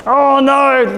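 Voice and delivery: high, loudish mock dismay; unhappy face voice. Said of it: "someone not happy".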